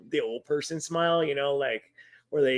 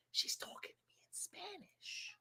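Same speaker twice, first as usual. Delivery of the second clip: breathy whisper aside; whispering to Kelsey mid-call; 12 semitones higher, 23 dB quieter than usual. Real, not acted. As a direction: whisper it so the caller can't hear.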